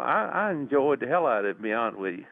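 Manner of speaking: usual speaking voice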